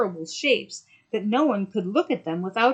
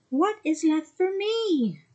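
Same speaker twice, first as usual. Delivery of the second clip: put-on a higher young girl voice; young mermaid voice; 10 semitones higher, slower than usual. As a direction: speak up as the little mermaid. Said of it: "said the little mermaid".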